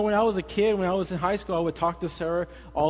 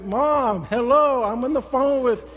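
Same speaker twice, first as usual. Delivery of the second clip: high, loud mock whine at mom; hammed-up teen indignation, stretched words; exasperated teenager; 5 semitones higher, 4 dB louder, slower than usual. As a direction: plead with your mother to get off the line. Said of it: "I'm like".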